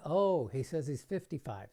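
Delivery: a magician mock-mishearing the age for laughs, loud; mock astonishment. Shown as a demonstration. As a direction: sell the silly mistake.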